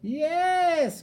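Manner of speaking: high, loud joyful yes; witch cries yes. Acted. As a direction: cheer it out. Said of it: "cried the witch".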